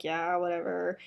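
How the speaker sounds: low, soft dejected brush-off; deflated, quiet acceptance; upset. Acted. Marina nodding to James while upset, dejected. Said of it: "she's upset".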